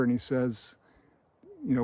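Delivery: usual speaking voice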